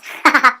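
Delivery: quick amused laugh; a brief laugh at herself; short laugh. Real, not acted. Laughs at own mix-up.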